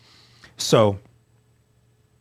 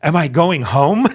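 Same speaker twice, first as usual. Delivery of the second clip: eager question, higher and louder, slow; hopeful; 5 semitones higher, 6 dB louder, slower than usual. Retold or imitated. Inmate in the cage hoping the judge frees him, eager. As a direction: ask it like freedom is one word away. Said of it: "'Cause I'm so hopeful".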